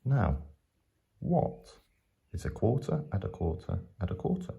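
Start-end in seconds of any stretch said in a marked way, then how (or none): none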